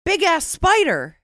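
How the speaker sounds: high, loud announcement; exclaimed title. Shown as a demonstration. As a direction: shout the title as if it ends in an exclamation point. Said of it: "has an exclamation point at the end".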